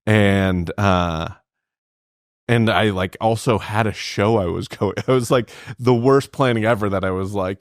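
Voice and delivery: usual speaking voice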